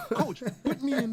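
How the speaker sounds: high, laughing mock plea to the coach. Eager rookie retelling his begging to coach, laughing. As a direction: beg half laughing.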